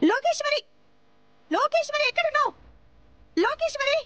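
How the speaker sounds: high, loud summons; angry calling out. Acted. Angry woman summoning Lokeshwari from inside the house, calling out furiously. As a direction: shout the name to summon her.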